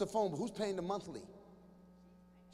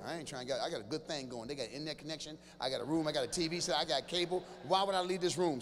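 much lower, softer, contented mumble; lazy teen; 7 semitones lower, 3 dB quieter than usual. Retold or imitated. Teen boy explaining why he never leaves his room. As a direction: sound too comfortable to move. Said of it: "He's like".

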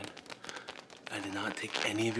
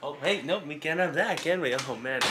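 louder, higher, startled mock panic; suddenly loud and flustered, as if caught; startled outburst; 6 semitones higher, 8 dB louder than usual. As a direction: caught in the act, blurting out loud. Intruder startled, victim screaming.

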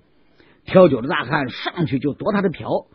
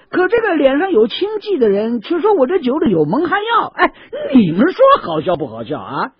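higher, louder complaining peddler; aggrieved seller; 12 semitones higher, 4 dB louder than usual. Acted. 挑酒大汉向卖枣人抱怨被冤枉. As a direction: play wounded and mock the accusation.

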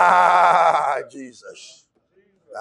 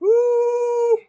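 a high joyful whoop; whooping praise; high ecstatic woo; 4 dB louder than usual. Real, not acted. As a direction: let out a high cry of joy. Ecstatic exclamation.

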